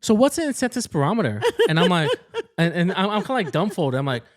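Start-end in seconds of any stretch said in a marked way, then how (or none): 0.0-1.4 high, loud, saccharine praise then a quiz; sugary condescending teacher; mock patronising praise. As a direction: talk down with fake delight. Retold condescending sister.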